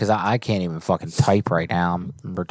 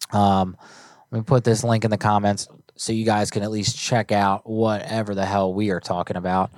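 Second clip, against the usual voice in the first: usual speaking voice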